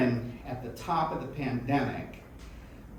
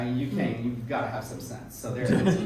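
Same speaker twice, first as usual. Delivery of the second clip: usual speaking voice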